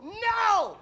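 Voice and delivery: loud, high no; demonstrating the crowd's shouted answer. Shown as a demonstration. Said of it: "I want you guys to go".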